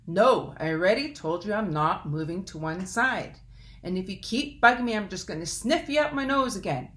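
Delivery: loud, fast, annoyed elk roar; crabby elk shouting. Acted. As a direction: bark it at the pest.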